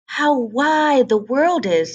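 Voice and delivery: slow, high, awed little ducklings; lightly voiced ducklings in wonder. A narrator's reading. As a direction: marvel at the world, slowly. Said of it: "said all the young ducks".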